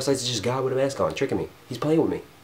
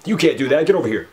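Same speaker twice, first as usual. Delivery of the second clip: louder, barked command; scolding order; 4 semitones higher, 7 dB louder, faster than usual. Acted. God in a mask scolding you, loud order. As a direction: shout it like a stern authority.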